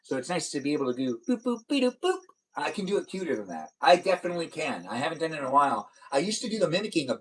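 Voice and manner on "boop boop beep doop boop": Betty Boop boops. Shown as a demonstration. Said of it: "that wonderful Betty Boop".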